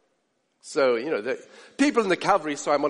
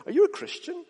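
anxious question; 9 semitones higher than usual. Retold or imitated. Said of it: "you turn to him and say".

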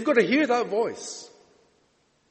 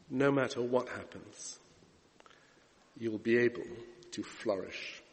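low, hushed, with long pauses; soft, solemn end of a sermon; gentle closing appeal; 9 semitones lower, 9 dB quieter than usual. Real, not acted. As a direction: ease the congregation down into prayer. Closing appeal leading into prayer.

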